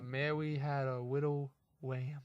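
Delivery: "widow wham" with w replacing each r, slow and careful; child saying "Mary had a widow wham" with w for r; says w for r. Retold or imitated. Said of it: "I couldn't say my R's".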